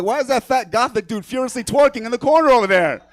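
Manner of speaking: incredulous yell from across the street; shouted question. Pretend. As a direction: call it out loud and high, mock-bewildered. Imagined passerby shouting across street.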